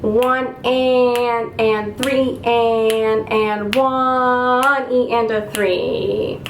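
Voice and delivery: slow, drawn-out syncopated counting; steady-beat rhythm count. Shown as a demonstration. Teacher showing students a clapped rhythm, slow steady count. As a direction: count it evenly, stretching the held beats. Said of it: "practice this by clapping first with a steady beat".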